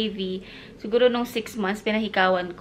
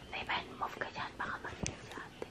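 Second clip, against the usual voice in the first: whispering; 20 dB quieter than usual. Real, not acted. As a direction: whisper so ate stays asleep. Don't wake sleeping sister.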